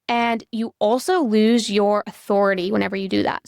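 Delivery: usual speaking voice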